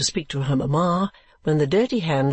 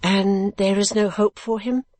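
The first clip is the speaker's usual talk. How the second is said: higher, slower, pitying question; compassionate; 6 semitones higher than usual. Acted. Kate asking keeper about the madman, compassionate. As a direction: ask with tender concern. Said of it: "said Kate compassionately".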